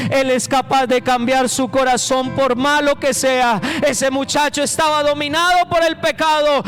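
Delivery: loud, driving preacher's call; fiery altar-call preaching; preaching at full force. Acted. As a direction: press the message home to the congregation.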